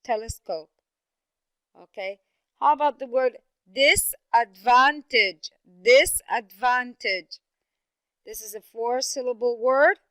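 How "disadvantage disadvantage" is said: slow disadvantage with DIS and VAN punched loud; stress on first and third syllables. Shown as a demonstration. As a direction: hit both "dis" and "van" in disadvantage, drawn out. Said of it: "it would be the first".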